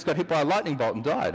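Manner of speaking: ordinary talk, nothing marked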